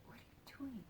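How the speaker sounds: urgent whispered question. Retold or imitated. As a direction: hiss it so nobody else hears. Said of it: "And I'm like".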